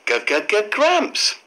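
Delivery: repeated hard G sound, then Gramps; G in short separate bursts leading into GRAMPS. Shown as a demonstration. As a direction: stutter out "g, g, g" as a phonics sound before "Gramps". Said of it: "g sound".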